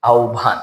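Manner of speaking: আহ্বান said as aubhan; আহ্বান with u and aspirated bh; আহ্বান as au-bhan; correct pronunciation. Shown as a demonstration. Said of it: "এটা হবে কি".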